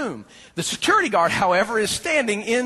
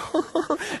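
laugh; 9 semitones higher, 4 dB quieter than usual. Real, not acted. Laughing at the story.

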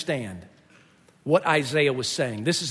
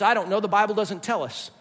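ordinary talk, nothing marked

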